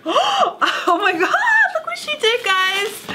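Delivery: high, slow squeal of disbelief with laughter; retold gasp of delight. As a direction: replay the moment you opened the mail, half laughing, half tearful. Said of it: "and I was just like".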